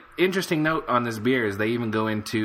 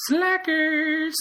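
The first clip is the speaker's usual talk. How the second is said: mock squeak. Jokey jab at reviewers.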